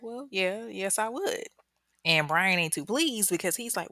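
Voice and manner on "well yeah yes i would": lightly voiced pastor, higher pitch; a hint of a well-meaning pastor answering; good pastor. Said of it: "the good pastor's like".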